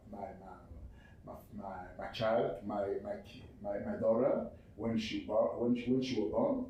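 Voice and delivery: normal talking voice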